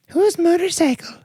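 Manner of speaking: put-on high, cooing girlish voice; Pulp Fiction girlfriend. Girlfriend cooing at her boyfriend, high and sweet. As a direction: coo it like a sweet, naive girlfriend. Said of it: "That's, that's Pulp Fiction".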